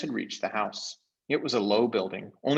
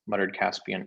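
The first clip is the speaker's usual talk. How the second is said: usual speaking voice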